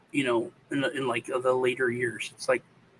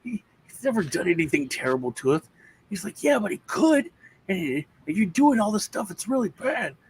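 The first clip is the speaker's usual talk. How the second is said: imitating his son; 8 semitones higher than usual. Teller voices younger son.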